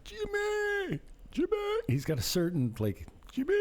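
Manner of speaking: high-pitched sing-song name call; mimicking Danielle. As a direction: imitate his high, chirpy calling of a name. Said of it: "I have to mimic it a little bit more".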